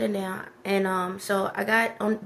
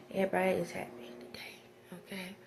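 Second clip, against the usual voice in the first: hushed whisper to the viewers; 10 dB quieter, faster than usual. Acted. Vlogger whispering a cheerful aside to viewers, hushed. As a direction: let it drop to a secret whisper.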